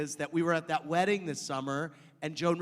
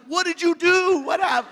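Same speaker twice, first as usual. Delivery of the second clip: excited shout; 13 semitones higher, 7 dB louder than usual. Retold or imitated. Twitter reactions voiced.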